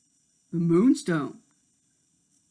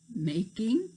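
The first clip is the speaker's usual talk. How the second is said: ordinary delivery, nothing marked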